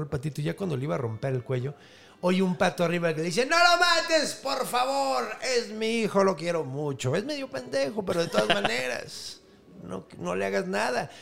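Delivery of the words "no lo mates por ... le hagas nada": high pleading duck voice. Acted. Mother duck begging the hero to spare her chick, high pleading. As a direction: beg in a high squeaky bird voice.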